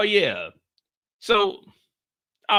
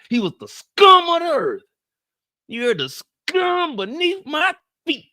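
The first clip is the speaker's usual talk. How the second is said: outraged shout; 11 semitones higher, 4 dB louder than usual. Real, not acted. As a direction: spit the words out. Angry tirade at Vi.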